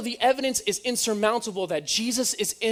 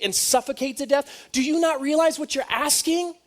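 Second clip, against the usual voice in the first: higher, incredulous Roman governor pleading; exasperated Pilate; 6 semitones higher than usual. Retold or imitated. Pilate pleads with the crowd, higher and incredulous. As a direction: plead with them in disbelief as Pilate. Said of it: "Pilate's sitting here going".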